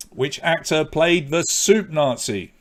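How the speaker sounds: slow, spelled-out repeat. Shown as a demonstration. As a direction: let each word land for the room. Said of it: "repeats the question".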